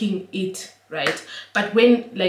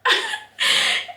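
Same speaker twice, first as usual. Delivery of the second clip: high airy laugh. Real, not acted. Laughing at the story.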